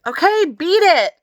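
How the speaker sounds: impatient brush-off. A narrator's reading. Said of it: "I said".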